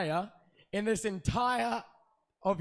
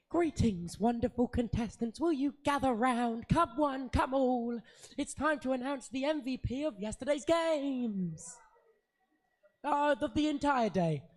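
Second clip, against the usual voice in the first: announcer voice; 4 semitones higher than usual. Acted. Announces MVP to the room.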